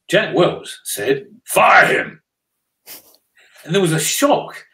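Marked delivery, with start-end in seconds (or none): 1.5-2.2 loud, abrupt quoted order; blunt command. Retold or imitated. Panel guest answering an audience question, blunt loud command. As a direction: deliver the verdict with a sudden punch. Acting Jack Welch's blunt verdict.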